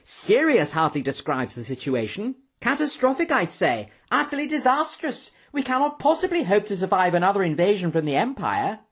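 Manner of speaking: high-pitched, alarmed professor; agitated outcry. A narrator's reading. Professor to the room on news of war, crying out agitatedly. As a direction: exclaim it with leaping pitch. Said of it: "cried Professor Jaggles, leaping up from his chair".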